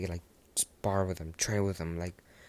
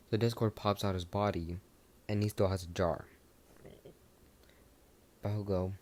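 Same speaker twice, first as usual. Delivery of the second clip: ordinary delivery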